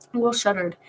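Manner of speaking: ordinary delivery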